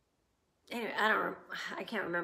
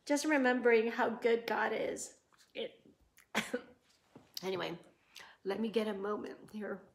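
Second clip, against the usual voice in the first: teary; faster than usual. Real, not acted. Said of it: "getting kinda teary about this".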